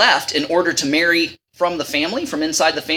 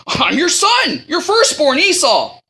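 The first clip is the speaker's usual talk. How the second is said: reading Esau; 7 semitones higher, slower than usual. Retold or imitated. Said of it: "And he said".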